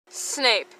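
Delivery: ordinary delivery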